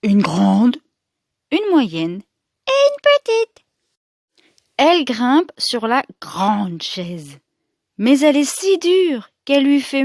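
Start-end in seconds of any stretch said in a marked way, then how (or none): 2.7-3.7 much higher, tiny little voice. A narrator's reading. Storyteller naming the smallest chair, tiny high voice. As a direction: make the word sound tiny.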